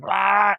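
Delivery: high mock battle cry; hammed-up wrestling grunt; mock wrestling roar. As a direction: roar like you're grappling an octopus. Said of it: "He's like".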